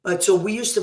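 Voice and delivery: usual speaking voice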